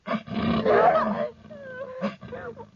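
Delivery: high, breaking laugh of pain and shock; high hysterical cry-laugh. Acted. Hysterical after the bite.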